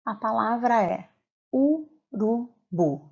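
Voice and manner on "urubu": "urubu" split slowly into u, ru, bu; slow, spaced-out "urubu" for students to hear the strong bu; syllable by syllable. Shown as a demonstration. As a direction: say "urubu" in three separate, raised syllables, pausing between. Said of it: "Qual é a sílaba mais forte".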